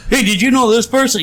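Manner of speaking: high-pitched mock inquiry; acted questioner.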